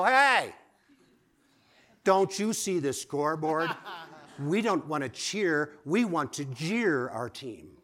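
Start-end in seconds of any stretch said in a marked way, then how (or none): 0.0-3.7 loud, high holler at the cheerleaders; calling out. Acted. Fan whose team is losing, calling out to cheerleaders. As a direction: holler it out in exasperation. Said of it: "and I wanna go".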